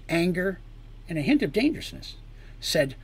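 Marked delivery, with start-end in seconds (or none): none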